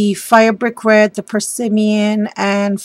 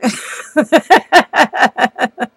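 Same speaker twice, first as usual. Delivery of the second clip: real giggling laugh; 4 semitones higher, 5 dB louder than usual. Real, not acted. Crafter tickled by the tag she just read, giggling laugh. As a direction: run of high, loud giggles. Amused after reading out tag.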